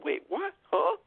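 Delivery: high-pitched baffled double take; acted confusion, questioning and high; mock mystified. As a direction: imitate someone utterly puzzled, pitch jumping way up. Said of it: "he was just mystified".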